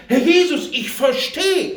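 high, loud cry breaking into a laugh; crying out to Jesus. Retold or imitated. Husband at the window during the birth, crying out loud. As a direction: cry it out to heaven, half laughing. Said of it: "ich schrie am Fenster".

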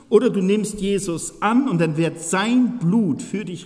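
fervent slow sermon warning; preaching. Acted. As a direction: preach it slowly with weight. Preaching at full force.

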